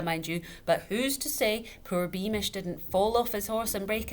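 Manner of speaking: high, loud wine-fuelled ranting; tipsy father lecturing the widow; loud tipsy argument. A narrator's reading. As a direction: hammer each point home. Tipsy father argues loudly.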